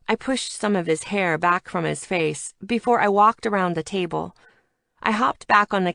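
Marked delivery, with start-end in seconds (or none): none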